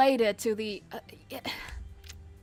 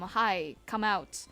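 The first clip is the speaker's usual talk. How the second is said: ordinary talk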